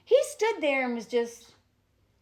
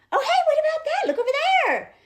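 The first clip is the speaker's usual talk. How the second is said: much higher sing-song voice, like dangling a toy; distracting a child; faster than usual. Showing how to distract a fixated kid, high sing-song. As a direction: pull a toddler's attention away, lilting and high.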